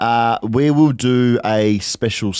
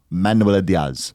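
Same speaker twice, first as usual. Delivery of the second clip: usual speaking voice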